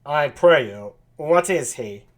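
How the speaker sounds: lightly nasal character voice; a hint of nasal put-on voice; nasally Benedick. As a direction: ask it a little through the nose. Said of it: "kind of a nasally sound".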